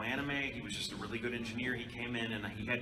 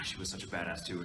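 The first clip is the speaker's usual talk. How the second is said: normal talking voice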